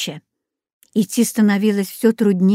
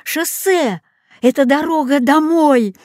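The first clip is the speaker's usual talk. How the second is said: high, jubilant announcement; joyful bark; 7 semitones higher than usual. A narrator's reading. Dog announces the road home, joyful. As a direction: proclaim the way home, voice lifted. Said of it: "рявкнул он радостно".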